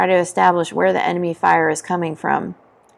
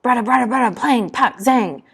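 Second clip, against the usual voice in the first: high-pitched mock machine-gun rattle and ricochets; rattled-off gun noises; imitated gunfire; 5 semitones higher than usual. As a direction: voice the weapons fire as sound effects.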